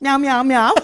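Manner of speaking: loud, high-pitched Scouse cat meows; reply in a string of Scouse meows; Gladys. Retold or imitated. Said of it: "it's meowing in a Scouse accent".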